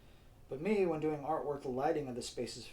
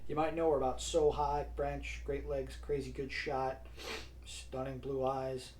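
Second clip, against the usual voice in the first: savoring; 3 dB louder, slower than usual. Acted. Teasingly lists girl's charms.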